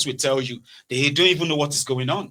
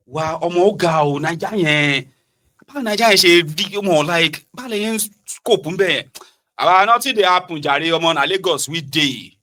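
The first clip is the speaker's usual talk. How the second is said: dismissive Lagos friend; 5 semitones higher, 5 dB louder than usual. Retold or imitated. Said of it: "And they say".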